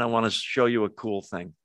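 ordinary talk, nothing marked

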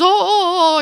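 flustered startled gasps. Retold or imitated. The woman called to the cockpit, high and flustered. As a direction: jump up in a fluster.

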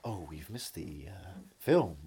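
slow, halting mock film host; quiet, drawn-out deliberation with pauses; imitating Adam West. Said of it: "He comes up at the end. He's like".

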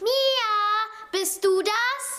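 calling out. Acted. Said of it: "Johanna rief".

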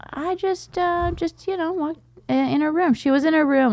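high, halting, guilty mumble; high sheepish confession; sheepish dad. Retold or imitated. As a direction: stall like a caught dad.

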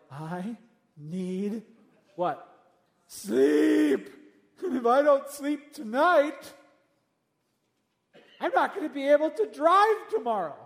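hammed-up exhausted parent pleading for sleep; sleep-deprived parent. Parent up all night begging for sleep, mock desperate. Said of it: "you're like".

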